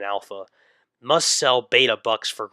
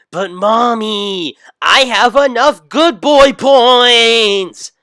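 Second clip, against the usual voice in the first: slow, far higher and far louder wail; whiny wail; 11 semitones higher, 13 dB louder, much slower than usual. Acted. Son wails to his mom that he has enough points. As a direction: wail it like a spoiled boy.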